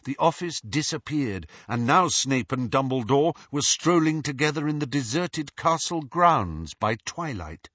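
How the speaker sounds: normal talking voice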